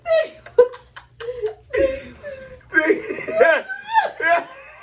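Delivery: high howling laugh; shrieking laugh. Real, not acted. Cracking up at his own old email, howling laugh. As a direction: lose it completely in a squealing laugh.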